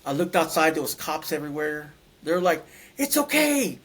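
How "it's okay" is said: much higher, eager retold voice; high-pitched reassurance. Cops outside promise to back him, high-pitched urging. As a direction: call out encouragement way up in pitch.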